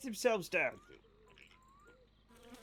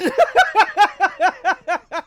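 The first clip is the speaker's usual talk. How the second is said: high loud burst of laughter; laughing at the corpse joke; 8 semitones higher, 9 dB louder than usual. Real, not acted. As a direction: laugh hard.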